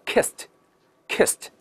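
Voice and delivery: slow "kissed" ending in a crisp t; "kissed" modelled slowly, the -ed as a light t; voiceless t ending. Shown as a demonstration. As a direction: say "kissed" as one syllable plus t. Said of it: "后面它直接发t".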